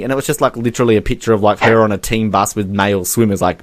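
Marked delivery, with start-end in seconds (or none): none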